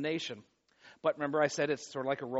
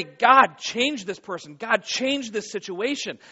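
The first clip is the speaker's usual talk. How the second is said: raised, higher-pitched pleading prayer; urgent prayer; 5 semitones higher, 8 dB louder, slower than usual. Acted. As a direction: implore God loudly, stretching the last plea. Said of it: "And you pray".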